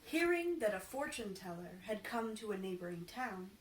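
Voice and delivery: plain reading voice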